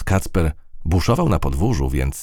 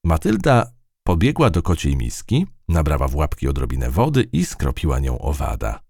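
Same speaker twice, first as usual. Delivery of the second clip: plain reading voice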